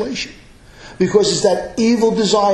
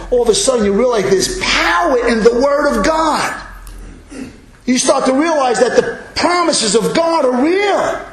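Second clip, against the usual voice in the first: raised, high-pitched testifying in a sermon; impassioned preaching; 5 semitones higher than usual. Acted. Preacher celebrating new life in God to congregation, impassioned. Preacher builds to full force.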